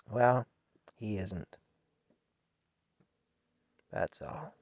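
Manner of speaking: barely getting the words out; choked up. Real, not acted. Ending the story of the murdered boy, almost whispering with grief. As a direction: voice dropping to a faint, breathy near-whisper. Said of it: "I'm sorry I got emotional".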